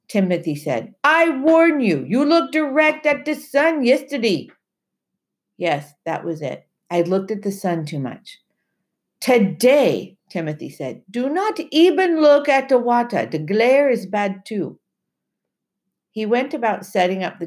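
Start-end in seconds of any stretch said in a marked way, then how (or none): 1.0-4.5 Timothy's voice. Acted. Timothy's voice in the story. 9.2-14.8 Timothy's voice. Acted. Timothy's voice in the story.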